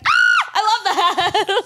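high, loud, slow gush through a laugh; delighted gush. Retold or imitated. Gushing to the Boston girl about her swearing, delighted and laughing. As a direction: swoon over it, laughing.

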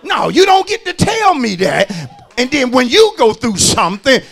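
high, loud pulpit challenge; full-force preaching. Real, not acted. Preacher confronting folk who don't follow their own advice, full-force preaching. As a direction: throw it back at them in a preacher's shout.

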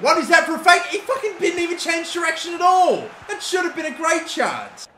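high, loud disbelieving outburst; can't believe the fake he just saw; incredulous yelp at a missed chance; disbelief. Real, not acted. Frustrated at a wasted chance.

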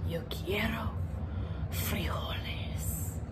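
slow hushed solemn whisper; somber whisper. Acted. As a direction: breathe it out gravely. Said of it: "in his most somber voice".

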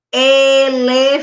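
loud "elefante", E stretched; opening E. Shown as a demonstration. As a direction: stress the first "e" of "elefante". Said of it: "Olha só".

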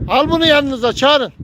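high, loud shout across the yard; loud call. Real, not acted. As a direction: call it out to people far off. Shouting to others to call bird.

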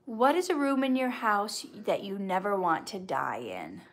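ordinary talk, nothing marked

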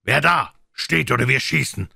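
loud, high sentry's challenge; shouted challenge. A narrator's reading. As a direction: call out a warning to the enemy. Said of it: "rief er die Piraten an".

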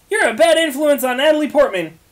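outraged parent yelling; scolding shout. Acted. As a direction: tell him off at full volume. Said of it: "Will screamed".

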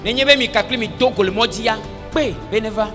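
ordinary talk

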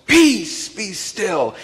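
Jesus rebuking the wind with authority; great authority. Acted. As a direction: command the storm to stop. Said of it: "says it with great authority".

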